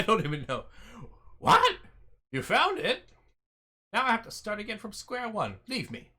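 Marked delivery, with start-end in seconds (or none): 1.4-1.8 sudden high, loud what; startled what. Real, not acted. Gamer startled when the puzzle is suddenly solved, loud and high. 2.3-6.1 high, loud, put-out NPC voice; indignant cryptographer. Acted. Gamer voicing the cryptographer whose code was cracked, indignant and high.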